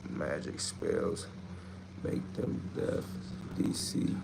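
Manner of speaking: slow, spooky unvoiced whisper like casting a spell; witchy whisper. Streamer reading a viewer's witchcraft comment in a slow spooky whisper. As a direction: hiss a spell slowly. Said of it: "what the fuck is you in, a witchcraft?".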